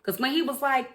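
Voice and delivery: ordinary delivery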